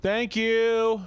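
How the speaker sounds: high, loud, bright thank-you; excited high thanks. Real, not acted. Repeats thanks.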